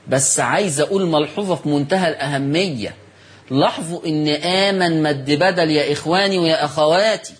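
normal talking voice

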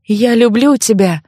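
usual speaking voice

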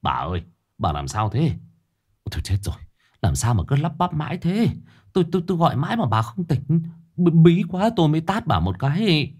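hushed worry rising into flustered explanation; Ông Lưu. A narrator's reading. Husband shaking wife out of a nightmare, alarmed. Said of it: "Ông Lưu hốt hoảng lay lay".

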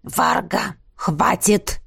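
low, hoarse male voice; hoarse rasp. A narrator's reading. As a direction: rasp out a curt rebuke. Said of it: "просипел мужчина".

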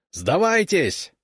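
high, slow shouted call; shouted surrender call. Acted. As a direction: call out a long demand to surrender. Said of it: "Он кричит на черкесском".

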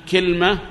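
كلمة as "kilma"; short i after k, no vowel after l in كلمة; second dialect form. Shown as a demonstration. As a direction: say كلمة as two syllables, kil-ma. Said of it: "ثلاث لغات".

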